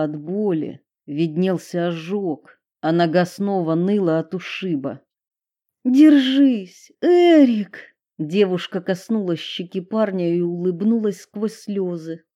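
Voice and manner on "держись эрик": put-on high, loud urging girl voice; worried girl. A narrator's reading. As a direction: urge him on through tears. Girl urges hurt boyfriend. (девушка в слезах подбадривает парня)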